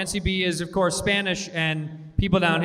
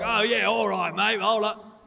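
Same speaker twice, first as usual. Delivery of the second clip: lightly voiced casual British bloke, friendly; a hint of a chummy London mate; casual British accent; 3 semitones higher than usual. Mocking how some British people talk, lightly in a casual British accent. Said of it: "British people that are like".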